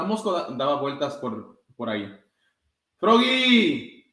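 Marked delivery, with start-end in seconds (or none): 3.0-4.0 loud high call across the field. A narrator's reading. Coach calls Froggy across the field, loud call. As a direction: call his name from the sideline. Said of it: "llamó la entrenadora".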